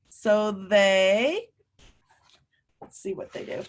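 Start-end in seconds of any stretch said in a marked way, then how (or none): 2.8-3.6 whispered, hushed; suspense whisper. Acted. Teacher building suspense before the page turn, whispered. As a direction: draw the children in with a secret whisper.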